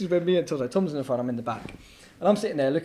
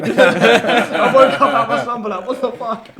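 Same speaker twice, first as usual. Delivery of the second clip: laughing; 9 semitones higher, 12 dB louder, slower than usual. Retold or imitated. Laughing at retold wake-up.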